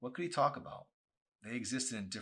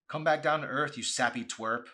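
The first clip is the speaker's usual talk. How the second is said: scornful jab; 7 semitones higher, 10 dB louder than usual. Acted. Chelsea snaps at Arson.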